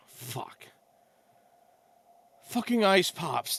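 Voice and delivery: ordinary delivery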